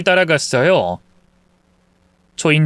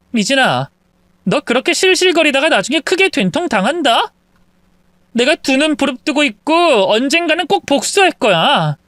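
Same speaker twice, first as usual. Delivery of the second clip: vengeful wife; 10 semitones higher than usual. Acted. Wife warning the gloating mistress, vengeful. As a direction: steely vow of revenge.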